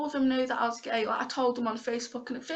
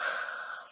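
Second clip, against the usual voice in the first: emotional sigh; 6 dB quieter than usual. Real, not acted. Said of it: "I'm getting really emotional".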